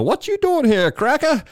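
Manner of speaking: squeaky hammed-up street challenge; high cartoonish tough-guy voice; mock tough guys.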